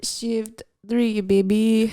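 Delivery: put-on a light French accent. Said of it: "like a French person".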